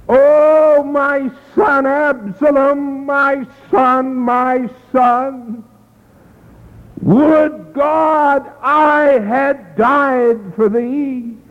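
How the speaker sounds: slow, drawn-out grieving cry of a father; a father mourning his dead son, mild sobbing lament; weeping lament. A narrator's reading. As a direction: let the grief pull each word out slowly. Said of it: "and wept".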